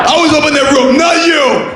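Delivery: Cliffhanger Stallone yelling. Retold or imitated. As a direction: bellow like a desperate action hero. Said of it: "Stallone's problem is he's Rocky".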